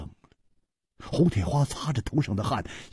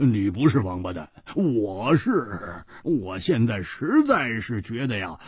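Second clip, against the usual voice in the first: 自嘲地苦笑着说，越说越高; 带着无奈的苦笑认输; 7 semitones higher, 3 dB louder than usual. Acted. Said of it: "苦笑着说". (胡铁花对楚留香自嘲苦笑)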